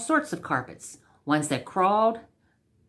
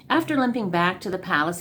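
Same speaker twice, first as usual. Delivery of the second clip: ordinary delivery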